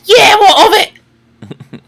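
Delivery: high, slow, shouted goblin squawk. Acted. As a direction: screech it like a cranky goblin. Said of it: "in his goblin voice".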